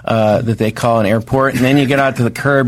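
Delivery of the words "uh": usual speaking voice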